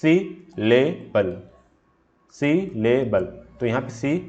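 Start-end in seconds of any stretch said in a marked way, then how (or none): 0.0-1.3 "syllable" very slowly in three separate beats. Shown as a demonstration. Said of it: "more than two syllable use होते हैं". 2.3-3.3 "syllable" split into three distinct beats; teacher separating each syllable of "syllable". Shown as a demonstration. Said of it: "more than two syllable use होते हैं".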